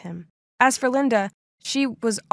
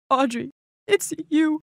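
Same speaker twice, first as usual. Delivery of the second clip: tearful; 5 semitones higher than usual. Acted. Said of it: "he started crying and hugging me".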